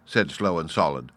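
plain reading voice

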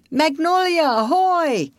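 high, drawn-out hailing call. A narrator's reading. Paul hailing the searching party, calling out. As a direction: hail a distant ship across the dark. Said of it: "cried Paul".

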